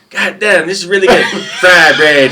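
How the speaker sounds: high, loud laughing exclamation; laughing drill sergeant. Retold or imitated. Drill sergeant tasting fry bread with syrup, high loud laughing shout. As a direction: shout the praise through a laugh.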